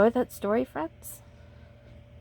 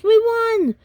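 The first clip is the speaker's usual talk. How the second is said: very high, loud victory cry; excited shout; 14 semitones higher, 8 dB louder than usual. Acted. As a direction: cheer the win. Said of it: "shouted the boys".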